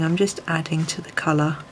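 usual speaking voice

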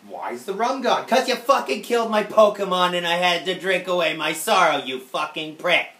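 loud hammed-up rant; mock rage. Answering friend's question, loud mock fury. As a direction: yell it in fake fury.

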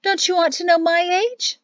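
put-on high, insistent old woman; indignant high question; demanding. A narrator's reading. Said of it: "Serafina demanded".

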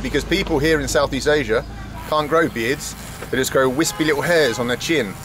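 ordinary talk

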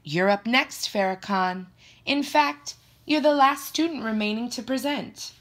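a hint of classroom authority; teacher calling on student. A narrator's reading. Miss Grant calls up the last student, lightly voiced teacher.

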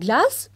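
Glass? said high with a rising question; questioning rise. Shown as a demonstration. Teacher querying the girl's word, rising Glass?. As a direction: lift Glass? as a puzzled question. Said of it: "teacher stresses misunderstanding".

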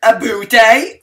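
loud, hammed-up Canadian with a high rising "eh"; mock Canadian accent. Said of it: "not how they say it in Canada".

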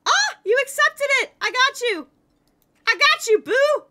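high, loud delighted burst; excited squeal. Real, not acted. Player thrilled her friend joined the party, excited squeal. Party invite finally accepted.

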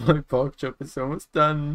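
high squeaky gleeful voice; giggly high cartoon voice; silly high voice.

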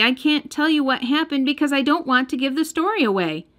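ordinary delivery, nothing marked

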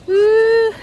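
loud, high cheer; excited cheer. Real, not acted. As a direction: let out a big woo. Cheering at the stadium.

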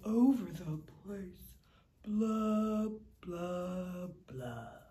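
low, dreary fish moaning his refrain; low mopey fish sighing blah blah; glum pout fish. Acted. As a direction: sink into the gloom of a sulky fish. Fish answers clam glumly.

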